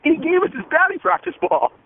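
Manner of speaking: imagining a dad's excited retelling at home. Pretend. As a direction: burst in to tell his son. Imagined dad telling his son.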